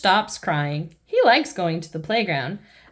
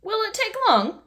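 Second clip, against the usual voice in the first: Mummy Pig asking; 13 semitones higher than usual. Acted. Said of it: "asks Mummy Pig".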